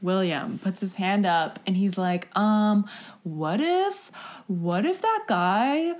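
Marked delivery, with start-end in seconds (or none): 2.3-5.9 halting, hesitant question. Retold or imitated. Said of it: "spends so much time getting his words out".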